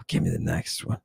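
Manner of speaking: sigh, then whisper it, fast; exhausted breathy aside. Acted. Lecturer asking for next slide in a tired whisper.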